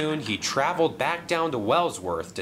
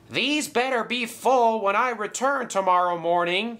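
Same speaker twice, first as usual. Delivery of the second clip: high-pitched calling-out, only slightly louder; mild shout; 10 semitones higher than usual. A narrator's reading. James warning whoever hides at the stand, mildly shouted. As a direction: call it out high, without full volume. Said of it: "he shouted loudly".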